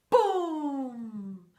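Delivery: loud, falling boom; big boom. Shown as a demonstration. Reader leading the kids in a big boom, loud. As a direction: boom like a heavy bear landing.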